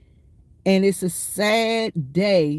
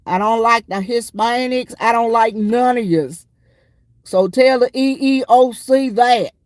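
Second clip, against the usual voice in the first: louder, higher mimicking of the sergeant's rant; mock sergeant rant; 5 semitones higher, 6 dB louder than usual. Soldiers imitating their first sergeant's rant, loud and mocking. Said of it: "and we'd be like".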